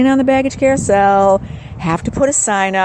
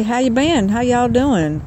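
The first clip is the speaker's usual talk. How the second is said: put-on friendly Southern drawl; imitation Southern accent, lilting higher; 4 semitones higher than usual. Shown as a demonstration. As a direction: blend in with a Southern twang. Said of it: "I could be talking like this".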